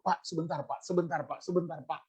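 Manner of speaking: a humbled officer backing down quietly; submissive. Retold or imitated.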